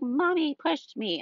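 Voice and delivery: put-on high, slow little-kid voice; imitating tattling toddler. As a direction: tattle to Dad like a three-year-old. Imitating toddler tattling to her dad.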